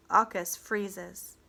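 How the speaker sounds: ordinary delivery, nothing marked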